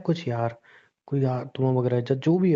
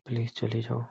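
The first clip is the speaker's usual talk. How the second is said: slow, soft entreaty; quiet pleading; 6 dB quieter, much slower than usual. Acted. Man urges reluctant Anjali to go on, quiet pleading. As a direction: draw out the name, urge softly.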